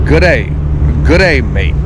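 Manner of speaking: G'day mate, clipped Australian style; Australian greeting, slurred together; Aussie accent. Shown as a demonstration. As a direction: squash Good day into one quick G'day. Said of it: "That's how you say to somebody".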